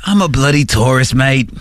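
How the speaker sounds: mock Aussie tourist; Australian accent. Redoing the driver's line as an Aussie, Australian accent. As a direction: put on a broad Australian accent. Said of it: "We're doing, like, Australian accents".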